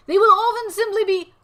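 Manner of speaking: high, loud narration, then slowing; dramatic reading. Pretend. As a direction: read it like a grand documentary. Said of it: "read it in a dramatic way".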